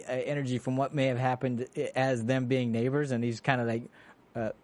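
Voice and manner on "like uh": ordinary talk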